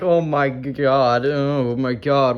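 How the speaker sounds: very slow, stretched-out exclamations; slow-motion play-acted dismay; drawn-out mock panic. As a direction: drag out each word in pretend alarm. Acting out passengers starting to fly.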